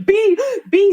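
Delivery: put-on high gasping child's cry of B; panicked gasp. Son gasping to mother after surfacing, panicked. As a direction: gasp it out like a scared boy.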